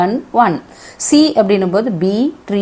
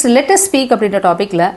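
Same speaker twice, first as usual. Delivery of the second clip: usual speaking voice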